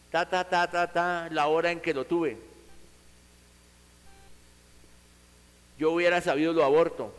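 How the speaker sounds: bitter rejecting parent. Acted. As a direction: spit out the regret of a resentful parent. Parent rejecting child harshly.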